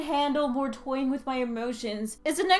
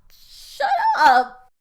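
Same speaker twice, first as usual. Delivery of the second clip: tearful snap; 8 semitones higher, 7 dB louder than usual. Real, not acted. Denying tears to friend.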